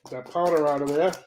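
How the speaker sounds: usual speaking voice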